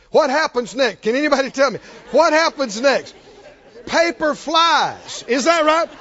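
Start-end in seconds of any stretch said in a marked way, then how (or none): 3.8-5.2 high, drawn-out exclamation; excited outburst. Shown as a demonstration. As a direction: call it out slowly to the crowd. Children ripping open presents, acted out.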